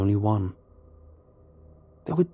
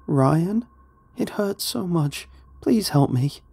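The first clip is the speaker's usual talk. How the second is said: high, pained woman's voice pleading; begging; 7 semitones higher, slower than usual. A narrator's reading. Wife in pain to Ryan, begging. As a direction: beg for help in a raised feminine pitch. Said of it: "she begged".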